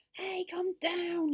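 high, loud, drawn-out call; long high-pitched yell; yelling elves. A narrator's reading. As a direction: yell it slowly up into a tree. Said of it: "yelled the elves".

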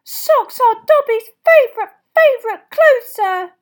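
delighted, loud, very high squeak; Dobby. A narrator's reading. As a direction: squeal it slowly with utter delight. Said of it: "Dobby was utterly delighted".

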